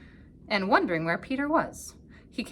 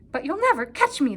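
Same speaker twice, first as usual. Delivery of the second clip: higher, louder chirp; chirping bird; 8 semitones higher, 6 dB louder than usual. A narrator's reading. Bird taunting the cat, high chirp. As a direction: chirp it back cheekily. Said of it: "chirped back".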